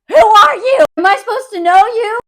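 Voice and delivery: loud, high mock panic; upset. Confused woman facing a stranger, loud high mock panic. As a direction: demand it in acted bewilderment. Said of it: "this scene is so upsetting".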